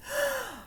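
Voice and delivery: sharp high gasp; a quick startled gasp. Acted. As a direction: catch your breath in surprise. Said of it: "Missus Strawberry gasped".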